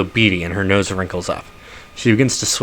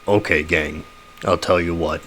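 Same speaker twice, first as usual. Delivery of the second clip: husky troll; 4 semitones lower, 3 dB quieter than usual. Acted. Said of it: "The troll flashes you a smile".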